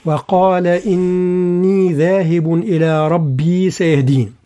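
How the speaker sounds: slow, drawn-out Quranic chant; Quran recitation. Shown as a demonstration. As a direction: recite the verse with full tajweed. Recites the verse in tajweed. (تلاوة مجودة بطيئة)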